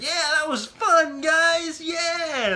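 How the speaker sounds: high, loud cheer; acted excitement. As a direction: whoop it up as if thrilled. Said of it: "I was just like".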